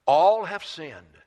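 preacher hammering the verse; emphatic scripture. Acted. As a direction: press each word slowly. Preacher quoting scripture with force.

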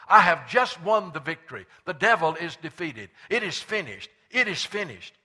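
loud, triumphant proclamation in a preacher's voice; forceful declaration, voicing Jesus on the cross; crying out. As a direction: cry out the victory at full voice. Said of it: "and he cried".

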